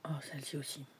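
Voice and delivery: soft whispered aside; just breathe the words, no voice. Real, not acted. Vlogger admiring another card for viewers, whispering.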